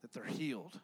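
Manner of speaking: soft, quiet, earnest prayer voice. Acted. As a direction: pray it low and hushed before the youth group. Opening prayer before sermon.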